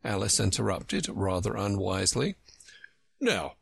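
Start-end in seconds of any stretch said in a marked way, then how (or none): none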